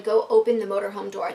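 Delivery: usual speaking voice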